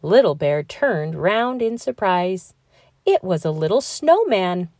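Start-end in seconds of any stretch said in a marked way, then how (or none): none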